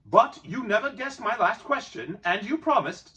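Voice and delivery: Bilbo insisting. A narrator's reading. Bilbo reminding Gollum of his promise. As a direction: hold him to his promise. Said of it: "said Bilbo".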